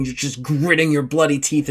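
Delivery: ordinary talk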